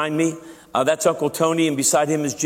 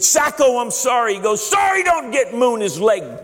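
put-on much higher, slower old war veteran voice; Uncle Tony voice; 8 semitones higher than usual. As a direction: tell his war story as the old veteran, pitched way up and slow. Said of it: "I wanna tell you the story in his voice".

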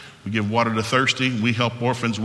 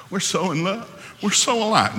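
high-pitched gushing of smitten sweethearts; mock lovestruck couple; 8 semitones higher than usual. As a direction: gush like a besotted dating couple, mockingly. Mimics starry-eyed dating couples.

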